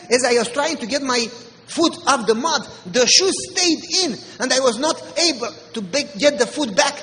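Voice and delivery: ordinary delivery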